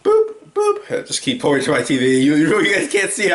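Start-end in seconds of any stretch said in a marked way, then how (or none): 0.0-0.9 two high-pitched little boops; playful boop sound. Pretend. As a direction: go boop, boop in a high electronic-beep tone. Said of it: "That's why I'm like".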